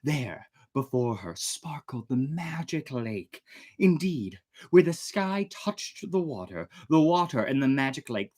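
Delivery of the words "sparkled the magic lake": plain reading voice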